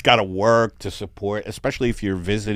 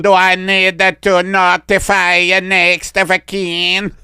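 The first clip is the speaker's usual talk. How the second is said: slow, higher, louder Italian; Italian alien accent; 8 semitones higher, 6 dB louder than usual. Pretend. Italian alien telling the doctor his first name, Italian accent. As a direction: introduce yourself grandly in Italian. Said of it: "you need to get a better Italian accent".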